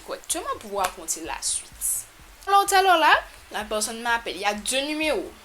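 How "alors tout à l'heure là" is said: high, loud restart; eager high burst of storytelling; excited restart. Real, not acted. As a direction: launch back in eagerly.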